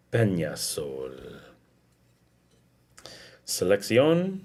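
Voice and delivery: slow, low wine commercial voice; dramatic ad read. Acted. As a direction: read the label like a luxury ad. Reads wine label dramatically.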